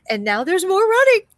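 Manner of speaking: loud, high, gleeful exclamation; excited outburst. Acted. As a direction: cry it out, swept up in the chase. Narrator excited at more running.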